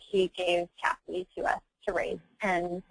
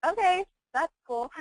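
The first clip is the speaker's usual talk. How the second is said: put-on nonchalant kid; 9 semitones higher than usual. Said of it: "he was like".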